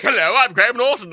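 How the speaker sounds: light cheery announcer voice; a loud bright hint of a TV host; Graham Norton. Train announcements in a TV host's voice, lightly imitated cheer. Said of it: "Graham Norton being like".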